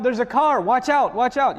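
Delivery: put-on calling a warning to a child in a parking lot; urgent warning call. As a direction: call out fast to stop a child near a car. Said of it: "I wanna tell the child".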